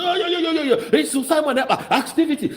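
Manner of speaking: high, laughing mimicry of the critics; mocking impression. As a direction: lampoon them with a squeaky, laughing voice. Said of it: "You say".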